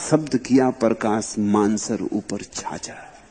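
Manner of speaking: slow, savouring recital of the verse; tender recitation. Shown as a demonstration. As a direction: linger lovingly on every word. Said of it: "ये बड़ा प्यारा वचन है". (प्रेम से धीमा पाठ)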